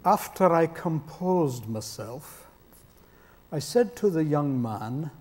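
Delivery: slow, soft Scottish brogue; Eric Alexander. Retold or imitated. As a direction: tell it as a composed Scots preacher, imitated brogue. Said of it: "the way Eric tells the story".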